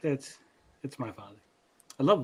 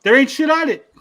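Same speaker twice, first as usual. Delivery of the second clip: gruff dad complaint; 8 semitones lower, 12 dB louder than usual. Retold or imitated. Dad's blunt verdict on Prime.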